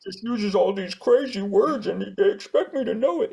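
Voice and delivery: high, squeaky mock-frustrated reader; mock confused reader. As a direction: whine it like a baffled reader.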